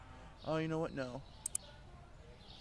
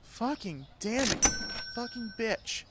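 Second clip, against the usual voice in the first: ordinary talk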